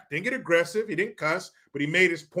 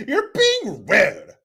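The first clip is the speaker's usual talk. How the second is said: slow whiny mock scold; 3 semitones higher, 4 dB louder, much slower than usual. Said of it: "That's what she said".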